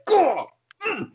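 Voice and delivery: mock agonized groan; pained grunt. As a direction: yelp and clamp down on the pain. Acts out biting inside mouth.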